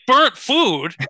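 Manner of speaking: high, loud outraged Burnt food?; indignant shout. Retold or imitated. Retelling the firefighter's radio call, outraged shout. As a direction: spit it back in disbelief. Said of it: "I'm like".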